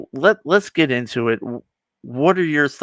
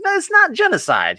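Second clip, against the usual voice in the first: much higher, indignant squeak; squeaky mock protest. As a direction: protest in a silly high voice. Thanos defends himself, retold comically.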